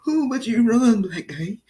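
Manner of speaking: high, loud mocking neighbor voice; mocking Steve. Steve mocking the man at the meeting, high squeal. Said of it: "[name] was going".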